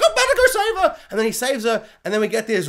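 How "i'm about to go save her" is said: excited rescue cry, pitched much higher. Retold or imitated. Rent-a-cop rushes to save his crush, excited cry. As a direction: shout it like an eager hero rushing in. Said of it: "he's like".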